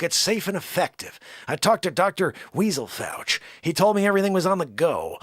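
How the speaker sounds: comic elderly-politician impression, swooping pitch; Biden. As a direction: rattle on in a lilting presidential parody. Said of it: "and Biden as well".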